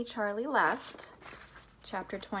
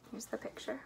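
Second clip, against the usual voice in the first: ordinary delivery, nothing marked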